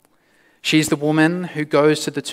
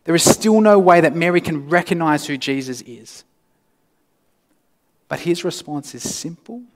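usual speaking voice

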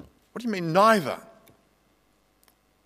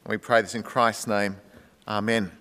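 low, hushed prayer voice; 6 semitones lower, 3 dB quieter than usual. Real, not acted. Pastor leading the congregation in prayer, hushed and low. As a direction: lead the flock in quiet prayer.

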